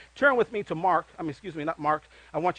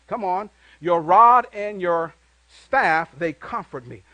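scripture recitation; 4 dB louder than usual. Acted. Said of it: "preacher reciting psalm".

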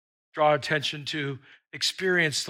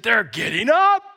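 louder, a thrilled shout to the angels; excited announcement; 5 semitones higher, 8 dB louder than usual. Acted. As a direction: announce it like great news.

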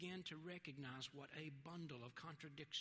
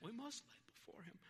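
high-pitched British accent, a little softer; 6 semitones higher, 4 dB quieter than usual. Shown as a demonstration. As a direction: try on a British accent for a quotation. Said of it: "do it in a British accent".

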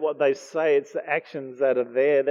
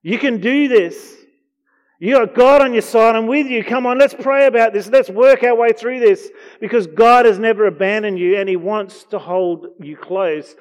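loud, high, rallying call to a fallen friend; a friend cheering you back on your feet; urging encouragement; 8 semitones higher, 11 dB louder than usual. Retold or imitated. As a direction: lift them up with fired-up encouragement. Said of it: "someone comes alongside us and says".

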